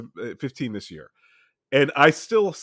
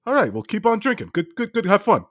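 friend's dad impression; 6 semitones higher than usual. Dad sending drunk kid back drinking, impression. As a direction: send them off like a dad, pitched up. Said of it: "He goes".

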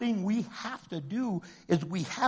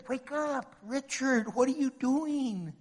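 put-on God pleading with his children, higher-pitched; God's wake-up call; 7 semitones higher than usual. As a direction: call gently from above, rising on the last call. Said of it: "He says".

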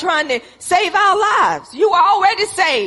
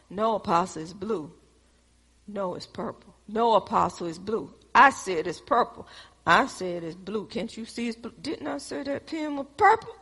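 low, much softer squabble; mock bickering; 7 semitones lower, 9 dB quieter than usual. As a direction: act out a petty quarrel under the breath. Acted quarrel over pen colour.

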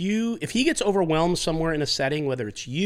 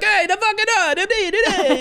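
hammed Italian owner. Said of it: "he's like".

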